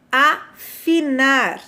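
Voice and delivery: "Afinar" drawn out slowly syllable by syllable; slow teaching demo. Shown as a demonstration. Teacher showing pupils a word, very slowly. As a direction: stretch "Afinar" out for the class. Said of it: "Vamos lá".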